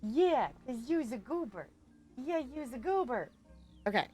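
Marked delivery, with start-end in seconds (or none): none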